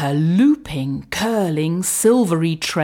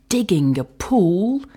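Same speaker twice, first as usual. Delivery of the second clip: usual speaking voice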